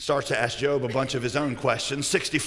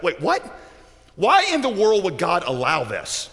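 baffled protest, stretched out; incredulous reader. Acted. Reader questioning why God would allow this, incredulous. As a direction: let the disbelief ring out on the question. Reader shocked at Job's story.

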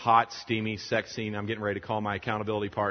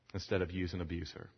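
ordinary talk, nothing marked

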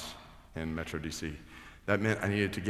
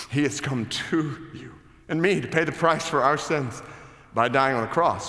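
excited retelling; 8 semitones higher, 9 dB louder than usual. Retold or imitated. Retells urging the driver.

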